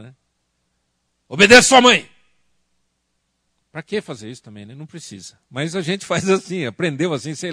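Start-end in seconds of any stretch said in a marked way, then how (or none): 1.3-2.1 put-on loud, stern barked command at the boy; stern order. Shown as a demonstration. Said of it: "engrossar mais a voz". (chamado severo e alto ao menino)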